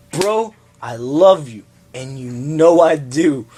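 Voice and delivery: slow, drawn-out pretend sincerity; half-joking, very slow declaration to a buddy; mock heartfelt. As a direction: stretch each word as if breaking it gently. Acts out letting friend down.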